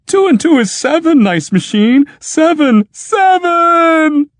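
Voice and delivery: very high, loud howl. Acted. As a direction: howl to drown out your friend. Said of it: "howled Clopasius".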